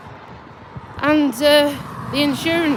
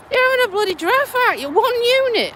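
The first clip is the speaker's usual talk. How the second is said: much higher, fast, disbelieving; incredulous retort; 11 semitones higher, faster than usual. Retold or imitated. Retelling her reply to the insurer, high and incredulous. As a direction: fire it back in fast disbelief, pitched way up. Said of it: "And I'm like".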